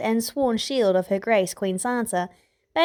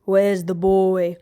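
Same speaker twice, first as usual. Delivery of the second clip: growled; 5 dB louder, slower than usual. A narrator's reading. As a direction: growl it out slowly like a bodyguard. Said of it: "Sandor growled".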